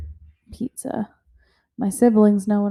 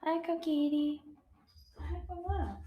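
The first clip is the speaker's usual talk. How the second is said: put-on high baby talk; 7 semitones higher, 15 dB quieter than usual.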